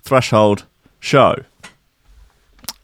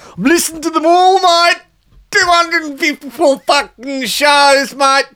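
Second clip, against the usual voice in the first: mock Australian. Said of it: "[name]'s, um, Australian".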